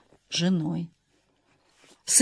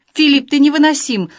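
higher, louder cry with tears in the voice; tearful outcry; 6 semitones higher, 4 dB louder than usual. A narrator's reading. Angel cries out at Philip on the phone, tearful and loud. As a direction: fling it out near tears. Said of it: "со слезами в голосе выкрикнул ангел".

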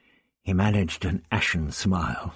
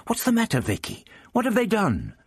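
urgent worry; 12 semitones higher than usual. Acted. Ian shakes unresponsive Vicky.

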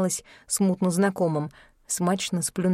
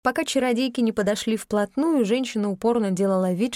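ordinary delivery, nothing marked

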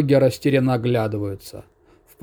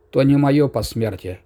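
ordinary delivery, nothing marked

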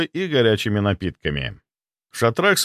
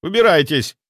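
high, loud bursts of command; shooing shout; 9 semitones higher, 4 dB louder than usual. Acted. Young man to hungry predators, shouted dismissal. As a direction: shout to drive them off, voice pitched up.

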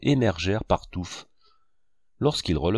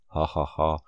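short low mocking laugh, ha ha ha; 5 semitones lower than usual. A narrator's reading. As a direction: laugh at him in three low beats. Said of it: "voyant de nouveau que Fauvarc riait". (rire moqueur, grave, en trois coups)

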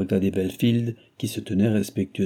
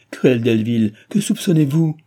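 loud, impassioned reproach; anguished reproach; 4 semitones higher, 6 dB louder than usual. A narrator's reading. Cécile reproaches her lover, anguished. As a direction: reproach him, voice raised.

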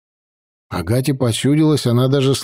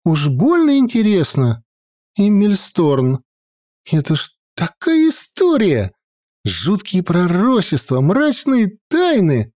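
put-on high, lively girl voice; sulky teenage granddaughter; young girl voice; 7 semitones higher than usual. A narrator's reading. Sylvia voiced.

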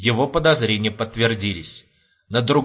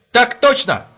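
high, loud snap answer; automatic military reply; 12 semitones higher, 6 dB louder, slower than usual. A narrator's reading. Private confirms to the major on reflex, loud. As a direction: answer like a drilled soldier. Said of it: "на автомате ответил Куравлёв".

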